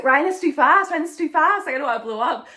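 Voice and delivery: high, loud mock-panicked protest; re-enacted mid-run alarm; retold panic. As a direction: plead with the pacer to ease off. Acting out complaint to pacer mid-run.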